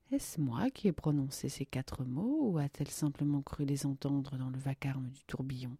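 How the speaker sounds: plain reading voice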